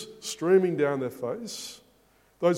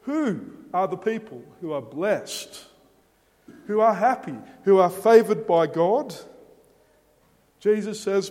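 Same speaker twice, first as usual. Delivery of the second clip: ordinary delivery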